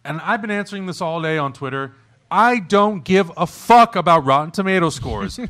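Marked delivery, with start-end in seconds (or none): 2.3-5.4 loud, slow, punchy rant; emphatic rant. Real, not acted. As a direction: hammer each word in frustration. Ranting about review scores.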